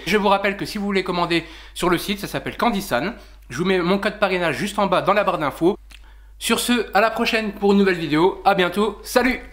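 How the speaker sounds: cheerful vlog goodbye to viewers; youtuber outro. Acted. Video outro sign-off.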